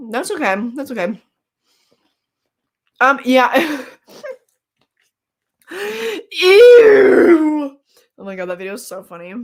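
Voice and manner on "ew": disgusted ew. Real, not acted. Reacting to something gross.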